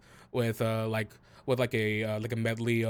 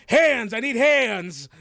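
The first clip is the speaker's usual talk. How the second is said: loud, slow, higher yell; shouting for help; 10 semitones higher, 8 dB louder, slower than usual. Acted. Harried chef yells to the kitchen for more hands, loud. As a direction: drag out a desperate kitchen call.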